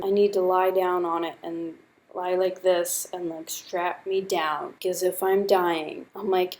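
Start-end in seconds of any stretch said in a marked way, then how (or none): none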